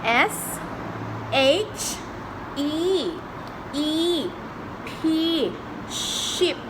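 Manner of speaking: put-on high singsong for a toddler; reading to a toddler. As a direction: coax a small child gently.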